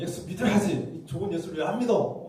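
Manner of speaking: harangue them into believing; preacher mocking pushy evangelists; pushy scolding. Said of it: "윽박 지르고".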